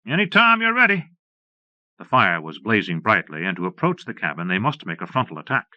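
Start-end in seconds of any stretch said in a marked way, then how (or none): 0.0-1.1 loud, high defiant call. A narrator's reading. Ranger daring the gunmen to attack, calling out loud and high. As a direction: toss the dare out to them.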